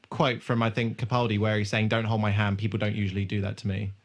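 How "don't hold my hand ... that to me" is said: ordinary delivery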